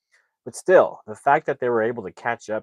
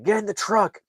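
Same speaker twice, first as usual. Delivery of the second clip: sharp, high retold yell of an angry cop; angry shouted command; mad cop yelling; 11 semitones higher, 4 dB louder than usual. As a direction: bark the order at him, much higher. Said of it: "the cop gets mad".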